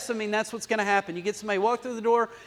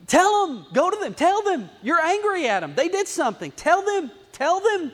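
urgent exhortation; 6 semitones higher, 3 dB louder than usual. Real, not acted. Preacher urges confronting others.

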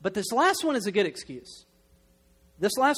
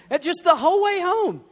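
normal talking voice

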